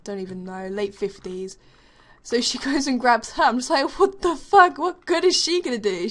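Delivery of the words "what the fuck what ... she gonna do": high, loud disbelief; watching the elderly teacher intervene, disbelieving; incredulous outburst. Retold or imitated. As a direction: scoff at the old teacher stepping in. Doubting the old teacher.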